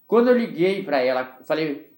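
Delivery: ordinary talk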